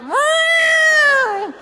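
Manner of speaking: hammed-up straining cat yowl; cat in labour. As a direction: wail like a cat giving birth.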